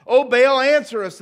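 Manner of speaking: loud, high cry to a god; shouted plea. Retold or imitated. Prophets of Baal call on their god, shouting. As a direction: shout it up to the heavens. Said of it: "they shouted".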